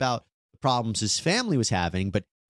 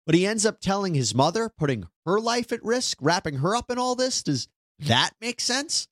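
high-pitched disbelief, slowing on the question; incredulous rhetorical question; 7 semitones higher than usual. Real, not acted. Host doubting he would endanger his mother, incredulous.

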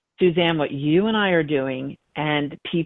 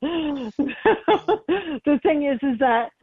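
higher-pitched words, still chuckling; laughing; 9 semitones higher, much slower than usual. Real, not acted. Medium getting back to her point after a lottery joke, laughing. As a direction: talk through the tail of a laugh.

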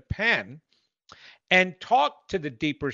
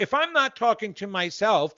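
usual speaking voice

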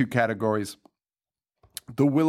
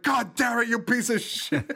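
much higher hammed-up rage; mock dinner-table shout; mock angry yell; 11 semitones higher than usual. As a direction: bellow it like a furious dad. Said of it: "And they're just like".

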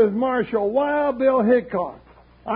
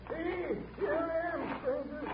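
distant call; 4 semitones higher, 14 dB quieter than usual. Acted. Hurt old-timer calling to strangers, faint distant call. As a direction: call weakly from a long way off.

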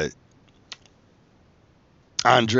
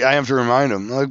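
ordinary talk, nothing marked

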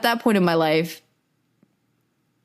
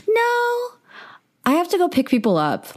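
much higher, blurted no; hasty refusal; 13 semitones higher than usual. Retold or imitated. Retelling how she turned down his offer to stay, high and hasty. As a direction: jump up high and brush him off. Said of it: "it was the way I answered".

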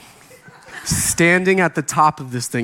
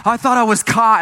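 higher-pitched imitation of a relieved student; mock relief; 5 semitones higher than usual. Student relieved to see only the youth pastor, mock relief. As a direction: gush with exaggerated relief.

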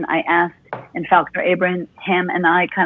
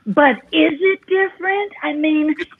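theatrical rebuttal; 8 semitones higher than usual. Pretend. Coach challenging the interviewer's distinction, high and theatrical.

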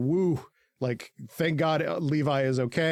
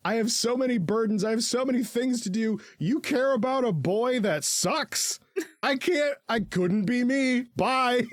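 high, whiny mock voice for Armin; a high, self-pitying put-on voice, laughing through it; 10 semitones higher than usual. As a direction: play him as a spoiled brat, pitched way up. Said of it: "And Armin is like".

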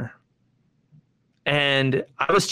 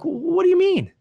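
much higher, squeaky outraged question; can't believe what he heard; outraged disbelief. Retold or imitated. Player told his attitude sucks, outraged and high.

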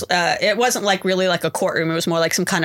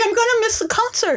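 panicked whine. Retold or imitated. Retold panic at arrest.

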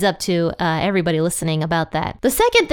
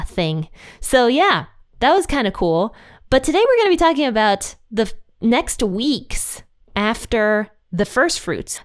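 usual speaking voice

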